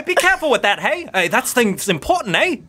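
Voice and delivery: high, loud, indignant telling-off; scolding game character. Acted. Game master as character warning a clumsy newcomer, scolding. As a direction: call out a sharp, high-pitched warning.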